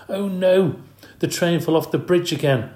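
a mild exclaim of dismay. Acted. Boy sees his toy train wrecked, mildly dismayed exclaim. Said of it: "Art exclaimed".

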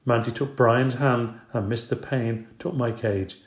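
ordinary delivery, nothing marked

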